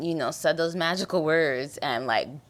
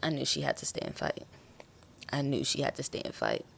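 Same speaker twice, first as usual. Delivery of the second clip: choked up; 3 semitones lower, 7 dB quieter than usual. Real, not acted. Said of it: "I'm about to cry".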